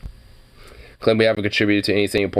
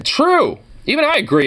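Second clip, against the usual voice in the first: excited agreement. Real, not acted. Agreeing that only sweet tea will do, excited high exclamation. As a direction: burst out in agreement.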